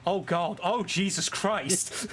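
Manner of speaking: slow, dragged-out groan of realization; drawn-out mock horror at his own work; dawning dismay. Rereading his own work messages, a slow dismayed groan. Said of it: "I looked at it and went".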